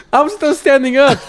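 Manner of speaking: high-pitched comic outrage; incredulous, high and amused; laughing indignation. Retold or imitated. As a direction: protest in disbelief through a laugh. Comic retold outrage at being abandoned.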